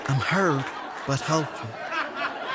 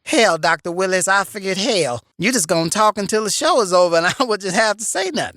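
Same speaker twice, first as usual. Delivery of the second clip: sassy grandma voice; 4 semitones higher, 6 dB louder, much faster than usual. Acted. Plays Medea character.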